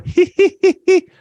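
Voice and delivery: very high mocking hee-hee titter; Mika's giggle. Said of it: "did you hear Mika go".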